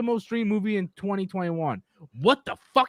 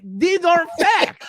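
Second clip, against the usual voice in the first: raised, drawn-out protest; exasperated; 9 semitones higher, 5 dB louder, slower than usual. Real, not acted. Debunking a commenter's list of facts, exasperated. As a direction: burst out in disbelief, stretching each word.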